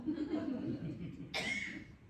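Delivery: a short disbelieving laugh from the cousin; amused laugh. Acted. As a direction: laugh it off, rising. Clyde laughs at loan request.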